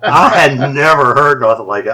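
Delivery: can't stop laughing while telling it. Real, not acted. Telling friends about the scary recording, laughing loudly.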